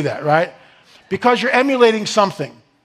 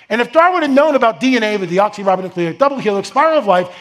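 usual speaking voice

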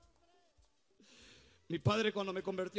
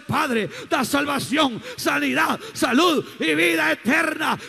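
preaching at full force; 10 semitones higher, 16 dB louder than usual. Acted. Street preacher shouting his testimony to the crowd, fiery preaching.

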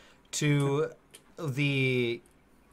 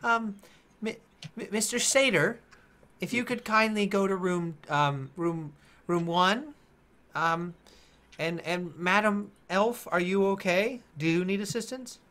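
polite gnome staff; 5 semitones higher than usual. Acted. Gnome staff politely assigning rooms.

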